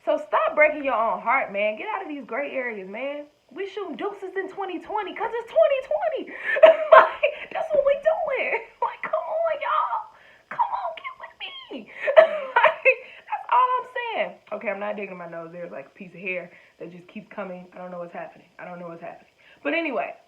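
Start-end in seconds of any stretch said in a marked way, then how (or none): 3.5-14.3 high, laughing rally cry; giggling, excited come-on to the crowd; hyped laughing pep talk. Real, not acted. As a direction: laugh and hype them up to join you. Hyped rallying call to viewers.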